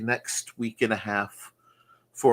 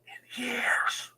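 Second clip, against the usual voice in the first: whisper; 12 semitones higher, 4 dB quieter than usual. Pretend. Tacked-on jab at McMahon.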